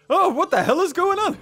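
very high, loud alarm; loud, high yelp of disbelief; startled outburst. Real, not acted. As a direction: cry out in shock, pitch shooting up. Startled by the game.

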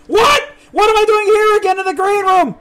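high, loud disbelieving cry; panicked yelp. Real, not acted. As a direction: voice jumps up in alarm. Trapped in strange game room.

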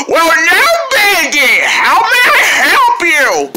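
high, loud, drawn-out lady voice; loud flamingo lady. Acted. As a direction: stretch each word like an overexcited bird lady. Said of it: "I'm not gonna get used to her voice".

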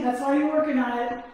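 usual speaking voice

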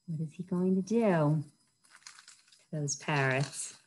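ordinary delivery, nothing marked

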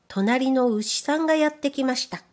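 plain reading voice

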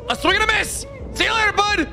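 high, triumphant jeer; excited taunt. Real, not acted. Gamer escaping the monster's swing, jeering. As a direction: crow over the dodge.

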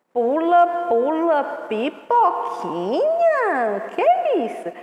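put-on high, bouncy call to the kids; playful excitement. Brincadeira animada com as crianças. (chamando as crianças, animada e bem aguda)

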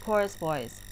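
ordinary talk, nothing marked

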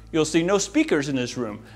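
usual speaking voice

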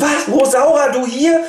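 high, loud shocked cry. Acted. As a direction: gasp out her name in disbelief. Shocked discovery. (schockierter Ausruf, hoch und laut)

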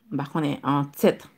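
ordinary delivery

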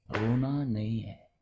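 slow, drawn-out restraint; controlled warning. Acted. Parent telling Adi not to cry, slow and controlled. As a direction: stretch each word in a measured warning. Parent repeats order more softly.

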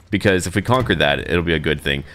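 ordinary talk

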